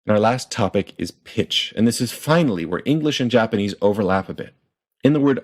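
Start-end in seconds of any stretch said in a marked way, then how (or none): none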